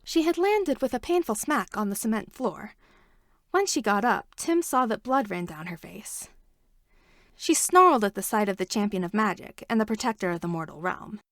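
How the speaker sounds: plain reading voice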